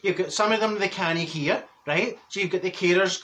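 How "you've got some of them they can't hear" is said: ordinary talk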